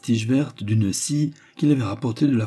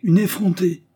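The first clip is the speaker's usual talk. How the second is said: grogné; 11 semitones higher, 5 dB louder than usual. A narrator's reading. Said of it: "grogna monsieur Noël".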